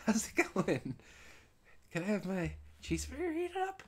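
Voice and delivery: butter up the grumpy clerk in a high sweet voice; overly friendly voice. Retold or imitated. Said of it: "trying to be all friendly with my tone".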